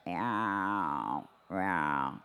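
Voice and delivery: low chest meow to contrast. Shown as a demonstration. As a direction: meow sung low, below the pencil. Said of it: "below the pencil".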